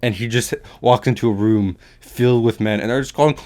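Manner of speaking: ordinary talk, nothing marked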